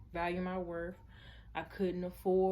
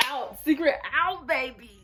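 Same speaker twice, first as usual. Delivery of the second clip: hyped; 5 semitones higher, 9 dB louder than usual. Real, not acted. Signing off, hyped.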